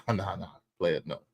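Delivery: low, soft corrective murmur; father schooling son. Retold or imitated. Dad setting his son straight on misconceptions, low quiet correction. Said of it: "I had to school my son".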